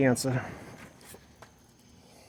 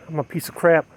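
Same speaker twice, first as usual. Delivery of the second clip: quiet despair; 4 dB quieter than usual. Retold or imitated. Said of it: "Is that what she said?".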